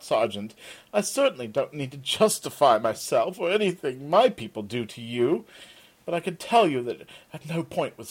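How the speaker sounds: stiff, offended self-defence; haughty merchant protesting innocence; a touch of pompous outrage; indignant. Acted.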